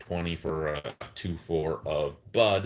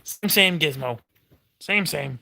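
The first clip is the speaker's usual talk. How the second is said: talking to a dog; 6 semitones higher, 4 dB louder, slower than usual. Real, not acted. Cooing at the dog.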